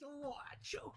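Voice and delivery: real sneeze; a real sneeze slips out; sneezing. Real, not acted. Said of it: "Do I wanna sneeze?".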